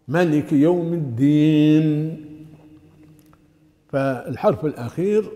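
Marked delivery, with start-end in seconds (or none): 0.1-2.2 "مالك يوم الدين" slow and louder, closing on a held n; clear final letter. Shown as a demonstration. Teacher modelling recitation, last letter clearly sounded. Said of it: "يجب أن يظهر ساكنا".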